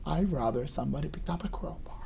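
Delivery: ordinary talk, nothing marked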